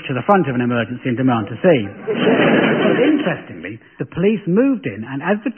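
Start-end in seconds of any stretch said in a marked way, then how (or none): none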